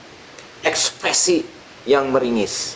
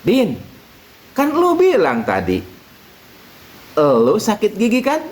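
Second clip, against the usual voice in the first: higher, patient questioning kiai; 8 semitones higher than usual. Acted. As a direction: gently lead Udin on. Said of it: "Pak Kiai bilang lagi". (kiai bertanya sabar, nada tinggi)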